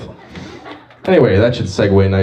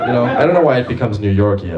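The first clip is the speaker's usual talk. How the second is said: usual speaking voice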